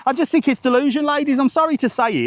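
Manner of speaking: playful exaggerated declaration. Shown as a demonstration. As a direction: push it up and out like a punchline. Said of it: "repeated louder and higher".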